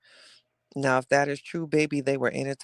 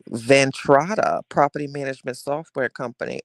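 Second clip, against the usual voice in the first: ordinary talk, nothing marked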